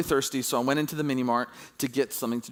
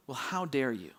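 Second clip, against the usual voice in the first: soft, mock-offended retort; low-key imitation of wounded entitlement; quiet affront; 3 semitones lower, 8 dB quieter than usual. Entitled woman answering anyone who challenges her, quietly affronted.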